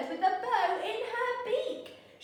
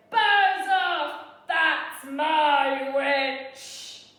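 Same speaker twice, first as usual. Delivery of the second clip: loud, very slow, strange monster voice; monster growl; 8 dB louder, much slower than usual. Acted. Beast warning the dragon away, loud and slow. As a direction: drag each word out like a huge beast.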